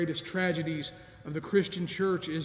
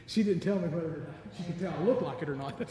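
chuckling through the words; amused aside, real laughter; laughing; faster than usual. Real, not acted. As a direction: let a laugh bubble through. Laughing at his own joke.

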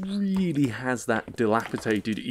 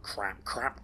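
usual speaking voice

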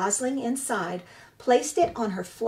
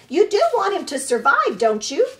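high, loud squawking goose voice; squawking old goose; 7 semitones higher, 8 dB louder than usual. Acted. Old goose squawks at the robot about the orphaned hatchling, high and loud. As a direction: scold like an indignant old goose.

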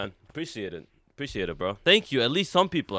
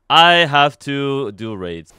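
slow, loud drawn-out complaint; 8 dB louder, much slower than usual. Real, not acted. Gamer streaming, slow loud drawl.

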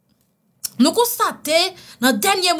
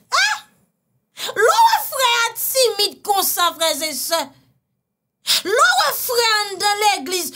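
high, slow mock wail; hammed-up crying sermon; wailing preacher voice; 8 semitones higher, much slower than usual. (prédication en pleurs exagérés)

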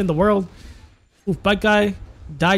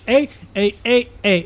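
ordinary talk, nothing marked

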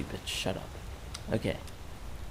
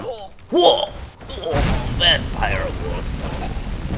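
startled; 8 dB louder, much slower than usual. Real, not acted. Startled by a vampire wolf.

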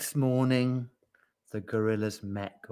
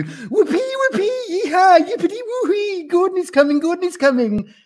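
squealing, very high whooping; excited babbling; 10 dB louder than usual. A narrator's reading. Yak dances at Gordon's news, babbling excitedly. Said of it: "babbled the yak".

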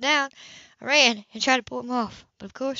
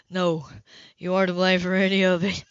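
a lightly voiced man, lower, at a loss for words; Cam, lower and shaken; 5 semitones lower than usual. A narrator's reading. Cam to Hannah after her story, lightly voiced, lower and stunned. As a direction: say it lower, as a man who can't find comfort.

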